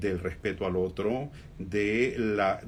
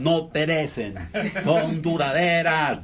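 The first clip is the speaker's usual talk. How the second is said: slow, loud, higher put-on character voice; drawn-out, declaimed mimicry; impersonation voice; 5 semitones higher, 6 dB louder, slower than usual. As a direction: do a hammed-up impression, stretching each word out loudly. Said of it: "Pero sí las haces".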